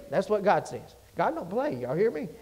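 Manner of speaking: ordinary talk, nothing marked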